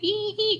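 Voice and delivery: tiny scared eek; high nervous squeal. Retold or imitated.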